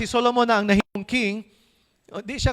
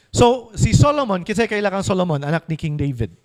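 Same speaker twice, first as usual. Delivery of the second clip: ordinary talk, nothing marked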